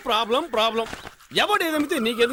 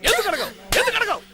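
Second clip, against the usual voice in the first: building demand; 7 semitones higher than usual. Acted. Father demands repeatedly.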